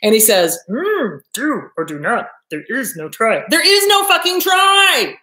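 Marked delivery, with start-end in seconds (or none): 0.7-3.4 put-on wise little swamp master voice; Yoda. Fed-up master correcting his trainee, Yoda impersonation. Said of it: "do my goddamn Yoda impersonation". 3.5-5.1 high, loud exasperated shout; fed-up yell. Acted. Fed-up master yelling at his whining trainee, exasperated shout. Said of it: "Yoda finally gets fed up".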